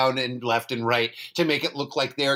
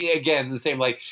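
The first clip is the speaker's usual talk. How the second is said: usual speaking voice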